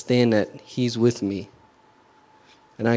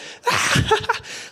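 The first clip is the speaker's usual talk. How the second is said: high airy imitated laugh; a sudden breathy laugh, playfully mimicked; mock burst of laughter. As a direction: imitate someone cracking up in church. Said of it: "someone just busts out laughing".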